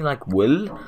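wool said in his own odd way, with a strange vowel; his odd way of saying wool. Shown as a demonstration. To viewers, showing how he says wool oddly. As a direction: show how you say wool, a bit oddly, so it stands out. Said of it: "I say it kinda weirdly".

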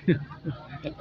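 short, soft amused laugh; soft chuckle. Real, not acted. Watched by an uncomprehending vendor, quiet chuckle. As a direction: laugh quietly under the talk. Amused at not being understood.

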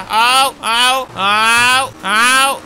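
loud yelped ows on each bump; repeated ow yelps. Acted. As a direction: series of loud pain cries. Bumping into posts.

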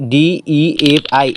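ডিইএফআই spelled out letter by letter, very slowly. Shown as a demonstration. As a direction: read ডিইএফআই out letter by letter for viewers to type. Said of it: "এখানে যেটা fill up করতে হবে". (ডিইএফআই অক্ষরে অক্ষরে ধীরে ধীরে বলা)

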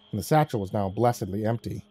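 ordinary delivery

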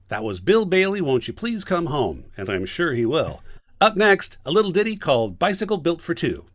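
high, punchy vintage announcer delivery; old-timey radio announcer. Acted. Voice director reads the radio show's one line, old-timey announcer. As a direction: sell it like an old radio host. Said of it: "It's an old-timey radio announcer".

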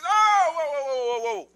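loud high cry tailing off; alarmed storyteller's "whoa"; urgent halt. Acted. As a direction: burst out to stop someone, then ease down. Urgently stopping a sword strike.

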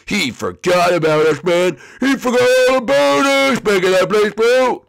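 building to a high, loud outburst; wounded yelling at a bro; outraged complaint. Acted. Figure complains of being forgotten.

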